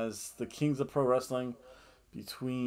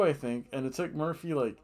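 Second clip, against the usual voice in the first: usual speaking voice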